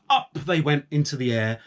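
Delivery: ordinary delivery, nothing marked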